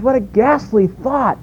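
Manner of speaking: high, mock-British exclamation; British accent. Preacher repeats Phillips in a high mock-British voice. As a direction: say it like a shocked Englishman. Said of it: "J B Phillips is British".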